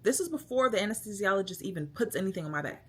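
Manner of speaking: usual speaking voice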